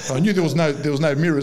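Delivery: laughing through the words; can't get it out for laughing at the prank. Real, not acted. Laughing at his own prank.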